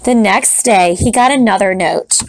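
usual speaking voice

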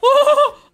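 high burst of laughter; loud laugh. Real, not acted. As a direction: crack up after the chaos. Laughs after the attack.

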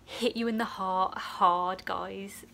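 ordinary talk

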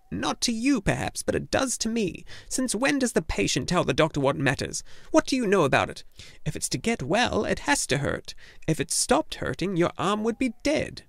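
put-on high, clipped-tempo old woman's lecture; scolding gnome healer. A narrator's reading. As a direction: tell off the patient like a stern nurse. Urgle lecturing Atreyu.

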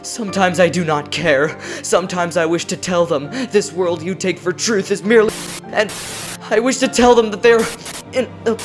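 usual speaking voice

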